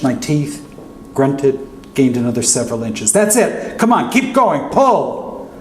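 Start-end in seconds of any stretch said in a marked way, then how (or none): 3.1-5.5 urging yell. Retold or imitated. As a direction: drive them on, yelling encouragement. Urging during desperate rescue.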